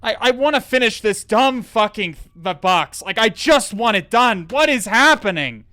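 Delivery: high, loud, fed-up venting; real anger. Real, not acted. Streamer losing patience with a dead box, loud real anger. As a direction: let the anger boil over. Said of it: "I'm actually pissed now".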